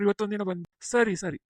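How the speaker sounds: ordinary talk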